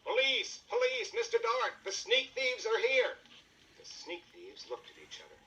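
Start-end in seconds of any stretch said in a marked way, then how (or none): 0.0-3.2 desperate alarm call, far higher and louder; loudest shout. Acted. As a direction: yell for the police at the top of your voice. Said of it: "Then he shouted in his loudest voice".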